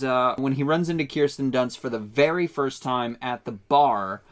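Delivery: ordinary delivery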